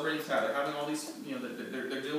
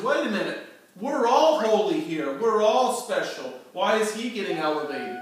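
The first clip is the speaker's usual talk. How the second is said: louder, higher indignant complaint; indignant protest; 5 semitones higher, 9 dB louder than usual. Acted. Korah's men protesting against Aaron, loud and indignant. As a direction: object loudly as if wronged. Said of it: "They said".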